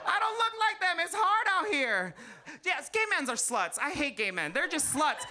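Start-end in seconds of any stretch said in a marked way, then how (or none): 0.1-2.1 comic wail. Acted. Comic whining to the crowd about her looks. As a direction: whine it out big. Mock despair, laughing shout.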